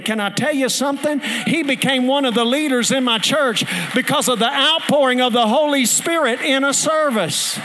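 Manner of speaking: loud, rising revival preaching; fiery pastor preaching the story's payoff; preaching at full force. Acted. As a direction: build it up to a shouting climax. Preaching climax continues.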